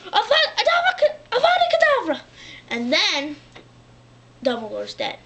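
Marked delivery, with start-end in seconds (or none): 0.1-2.2 very high, loud curse with a laugh; high-pitched gleeful shouted spell; killing curse. Retold or imitated. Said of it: "he's like".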